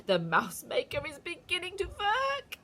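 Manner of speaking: high, slow, loud witch voice; gloating witch. A narrator's reading. Witch gloating to the audience, high and slow. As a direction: gloat over the spell.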